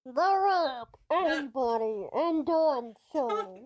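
talking through a covered mouth; muffled through hand. Real, not acted. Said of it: "without covering your mouth".

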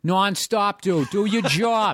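high Boston nonstop, dude; high mock Boston order, laughing; Boston accent. Shown as a demonstration. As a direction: bark it like a Boston local. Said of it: "that's Midwest".

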